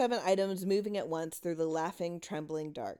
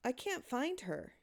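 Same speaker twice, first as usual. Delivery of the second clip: much softer, higher, defeated; quiet; 7 semitones higher, 6 dB quieter than usual. A narrator's reading. Boy telling the silent cellar he failed, softly. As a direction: say it softly into the dark. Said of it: "saying to the darkness".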